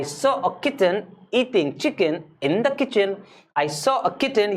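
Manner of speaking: very slow, each word pulled out cleanly; slow, word-by-word careful recital; slow and clear. Shown as a demonstration. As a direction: say every word carefully one by one. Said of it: "एक एक शब्द को सही से निकालो".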